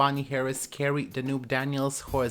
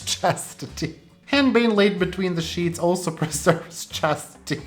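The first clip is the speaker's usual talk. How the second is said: giggling read; 4 semitones higher, 5 dB louder than usual. Real, not acted. Laughing while reading old herbal lore.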